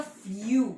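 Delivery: फ्यू as a drawn "fryu", gliding into rounded U; umlaut demonstration. Shown as a demonstration. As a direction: slide the umlaut in फ्यू from "i" toward "oo". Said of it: "नीचे से ऊपर करते हैं".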